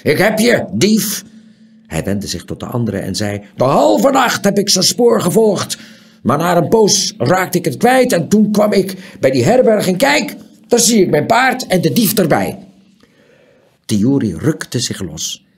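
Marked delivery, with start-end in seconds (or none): 0.0-1.2 high, loud grabbing yell; angry shout. A narrator's reading. Angry shout. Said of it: "hij riep". 3.6-12.6 angry. A narrator's reading. Angry owner tells others.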